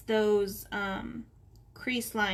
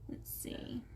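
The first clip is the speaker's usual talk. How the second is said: breathy whisper to self; 14 dB quieter than usual. Real, not acted. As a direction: whisper it under your breath. Muttering to self while drawing.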